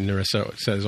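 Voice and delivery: normal talking voice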